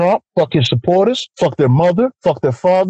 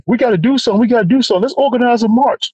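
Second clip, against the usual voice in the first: mock earnest activist; 7 semitones higher than usual. Mocking people calling for marches.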